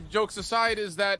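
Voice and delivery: normal talking voice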